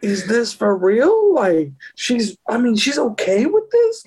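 high, drawn-out retold disbelief; comic astonished inner voice; incredulous. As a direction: pitch up, questioning what is happening. Retelling his incredulous thought.

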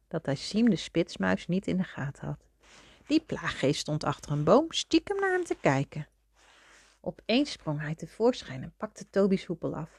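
usual speaking voice

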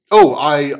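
a sudden high, loud oh; excited burst. Acted. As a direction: blurt it out eagerly. First guy's excited start.

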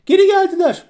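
very high, loud excited yell; high-pitched shout of news; astonished shout. A narrator's reading. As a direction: call it out high to the master. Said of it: "diye bağırdı".

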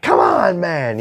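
mock angry yell, pitched high at the start; Kevin Smith yelling. Podcaster imitates a man yelling at his dog, angry. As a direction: imitate an exasperated dog owner shouting. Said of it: "the anger in his voice when he's yelling".

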